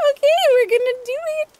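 high giddy squeal; excited. Real, not acted. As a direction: squeal it, breaking into a laugh. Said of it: "I'm excited".

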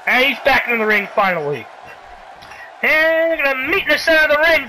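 usual speaking voice

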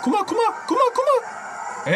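high, drawn-out mock call. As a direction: tease in a squeaky high voice. Mocks the fighter's moves.

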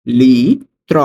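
"লিত্র" stretched loudly into two parts, long "li", then "tro"; slow dictation of "লিত্র" for learners; syllable by syllable. Shown as a demonstration. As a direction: draw out "li", pause, then "tro". Teacher repeats word by syllables.

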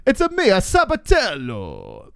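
high, hammed-up cartoon Italian, last word drawn out; exaggerated Italian accent, high and slow to finish; mock Italian accent. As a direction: sing out an Italian plumber-style catchphrase. Cartoon Italian riff on a name.

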